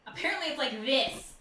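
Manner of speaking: loud, lifted, mock-booming delivery; projected voice. Shown as a demonstration. Player jokes about being too loud, projected voice. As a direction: project it to show off your voice. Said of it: "I have this wonderfully projective voice".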